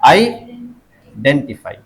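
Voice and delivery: "Identify" very slowly, a gap after आइ, then DEN-ti-fai; broken-down stress demo. Shown as a demonstration. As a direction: split आइ off, then hit डें in "identify". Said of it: "उसके पहले वाला कौन सा है?". ("Identify" धीरे, आइ के बाद रुककर)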